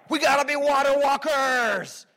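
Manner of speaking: high, slow hyped-up cheer for Peter; rally the crowd to get out of the boat. Retold or imitated. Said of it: "and we were like".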